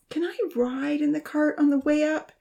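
put-on high, pleading little-girl voice; begging. A narrator's reading. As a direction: beg sweetly for a ride. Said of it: "Rosa begged".

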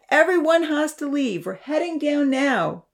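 angry shout toward the house; angry call. A narrator's reading. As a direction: urge everyone out, still cross. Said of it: "Damien called out angrily".

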